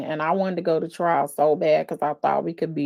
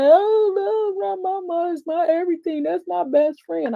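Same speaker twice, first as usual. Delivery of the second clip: much higher, wailing; imitating a crying granddaughter; 11 semitones higher than usual. The crying granddaughter at sentencing, wailing. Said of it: "she was just like".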